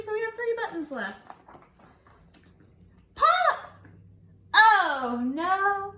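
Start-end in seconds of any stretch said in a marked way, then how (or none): 3.2-5.9 high, loud pop and oh no; hammed-up alarm. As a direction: burst out with the pop. Button pops in the story.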